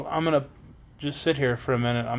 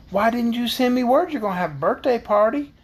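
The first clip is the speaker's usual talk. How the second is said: put-on higher-pitched little Kansas girl; lightly voiced Dorothy; 7 semitones higher than usual. Dorothy asks her friend about the party, lightly voiced girl.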